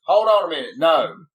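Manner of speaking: loud, firm dad stopping his son; dad cutting in. Retold or imitated. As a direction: bark it out like a dad saying wait. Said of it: "And my dad goes".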